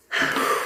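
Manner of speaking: heavy unvoiced puff of breath; out-of-breath sigh. Shown as a demonstration. Reader showing how Capricorn energy feels, winded sigh. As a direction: blow out air as if winded. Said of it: "like I feel like this".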